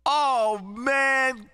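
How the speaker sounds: ordinary talk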